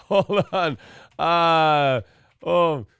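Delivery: usual speaking voice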